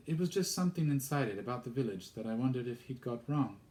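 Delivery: put-on high, quick girl's explanation; young girl's voice. Girl explains to vicar what she wondered, high and quick.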